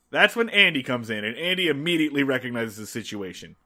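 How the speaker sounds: normal talking voice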